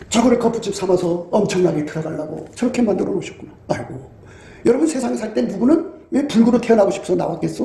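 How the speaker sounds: usual speaking voice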